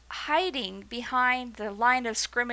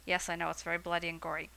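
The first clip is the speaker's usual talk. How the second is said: quick, hushed whisper to the audience; whispered aside; 6 semitones lower, 8 dB quieter, much faster than usual. Real, not acted. Teacher admitting the story is gory, quick whisper. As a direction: let them in on it under your breath.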